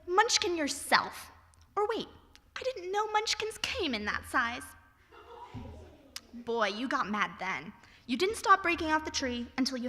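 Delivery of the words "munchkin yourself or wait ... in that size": sassy retort. Retold or imitated. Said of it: "so I said".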